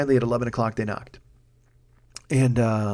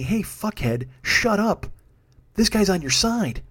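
self-scolding inner voice; 6 semitones higher, slower than usual. Retold or imitated. Voices his own inner scolding.

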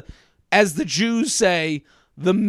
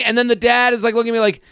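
ordinary delivery

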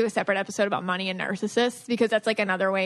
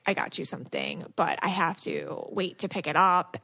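lower, softer imitation of husband; mock husband voice; 6 semitones lower, 5 dB quieter than usual. Wife teasing husband about the gift, lower mock voice. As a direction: play the husband, lowered and hushed. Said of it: "and you were like".

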